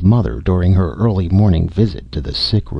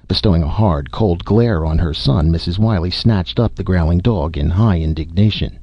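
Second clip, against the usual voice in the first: ordinary delivery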